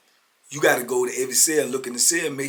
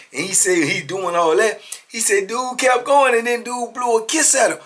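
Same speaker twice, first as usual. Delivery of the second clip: ordinary delivery, nothing marked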